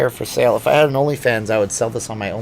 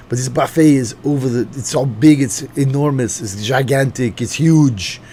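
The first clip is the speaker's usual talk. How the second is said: put-on Austrian-accented Arnold voice, a bit louder and slow in places; Arnold impression; 4 dB louder than usual. A streamer doing a mock Austrian Arnold for viewers. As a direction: ham up a Schwarzenegger accent for the chat. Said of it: "We could do the Arnold impression".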